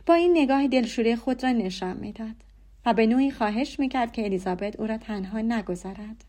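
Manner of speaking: plain reading voice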